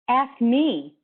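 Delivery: high, loud command; insistent command. Acted. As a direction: fire it straight at him. Coach laser beaming at him.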